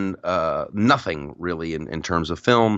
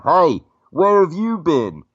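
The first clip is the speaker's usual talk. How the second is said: put-on high, loud, slow English-accented call; 8 semitones higher, 5 dB louder, slower than usual. Actor inside the Chewbacca mask to his co-star, English accent. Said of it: "talking with this English accent".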